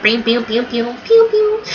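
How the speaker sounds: rapid bings and pews, rising at the end; playful spoken attack sound effects; imitated attack noises. Fan retelling Robin's attack, imitated zapping noises. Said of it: "She was like".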